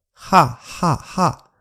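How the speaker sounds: 轻轻的哈; light English h. Shown as a demonstration. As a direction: breathe the 哈 out gently. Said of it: "英语发音那个哈很轻轻的".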